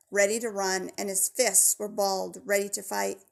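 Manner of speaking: usual speaking voice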